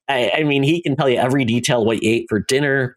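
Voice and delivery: usual speaking voice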